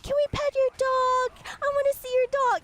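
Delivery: put-on high, loud eager children's pleading; squealed imitation of excited children; excited kids. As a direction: mimic kids clamoring all at once. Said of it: "they're all excited".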